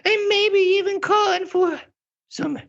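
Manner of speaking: very high, eager drama queen. Acted. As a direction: chirp it like a busy diva. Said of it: "this is my drama queen voice".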